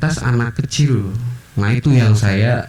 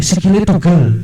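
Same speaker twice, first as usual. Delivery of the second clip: higher, louder mimicked kid; high childlike exclamation; Javanese child blurting; 8 semitones higher, 4 dB louder than usual. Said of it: "pas orang Jawa".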